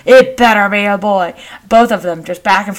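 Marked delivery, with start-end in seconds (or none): 0.1-1.3 insistent brother. Retold or imitated. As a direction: imitate a brother firmly arguing back. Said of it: "My brother".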